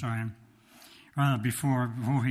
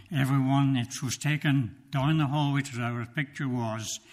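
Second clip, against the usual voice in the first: normal talking voice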